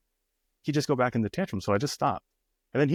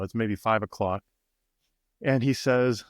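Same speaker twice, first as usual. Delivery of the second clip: ordinary delivery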